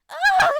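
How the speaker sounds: sudden high, loud startled shriek. A narrator's reading. Girl startled by a chicken, shrieking high and loud. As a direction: scream at the chicken jumping out. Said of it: "Hannah shrieked".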